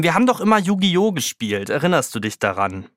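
usual speaking voice

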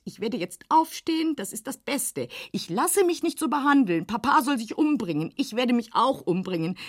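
usual speaking voice

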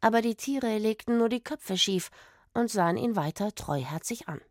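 ordinary delivery

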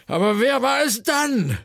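loud, high wail of frustration; exasperated high-pitched howl; yowling. A narrator's reading. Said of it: "jaulte Schnöpf".

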